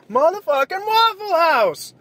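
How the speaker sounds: high, slow, loud yearning cry; belted craving. Real, not acted. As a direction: howl the name like a hungry fan. Repeats the name, belting it.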